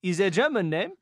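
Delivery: halting comic Frenchman; mock French accent. Player moving on to the puzzle, put-on French accent. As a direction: reason it out in a hammed-up French accent. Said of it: "speak like a, a Frenchman".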